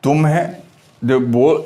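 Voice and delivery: ordinary talk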